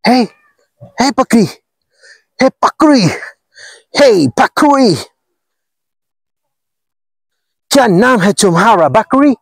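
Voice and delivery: high excited calling out; panicking gamer. Real, not acted. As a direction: call the goat in a squeaky high voice. Calling out to a goat.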